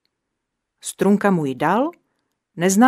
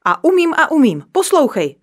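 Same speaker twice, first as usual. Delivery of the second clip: boastful shout, higher and louder; 7 semitones higher, 5 dB louder than usual. A narrator's reading. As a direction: yell it proudly at your friend. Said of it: "křičel Neználek".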